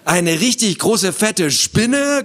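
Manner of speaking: high, rising horrified echo; mock screech. Frightened boy repeats his sister's words, mock screech. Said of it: "kreischte Theo noch lauter".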